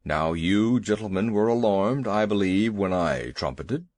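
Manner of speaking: a big worried beast demanding answers; lightly voiced elephant. Elephant Two Tails voiced.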